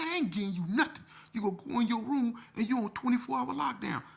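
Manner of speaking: loud, very high shouting; angry punishment order. Retold or imitated. Staffer sending youth to his room, angry shout. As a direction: lay down the penalty at a shout.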